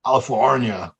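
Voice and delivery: put-on Californian accent on one word; mock Californian accent. Joking about foreigners doing Californian accents, mock Californian. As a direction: playfully exaggerate a Californian accent. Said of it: "Or they do Californian accents".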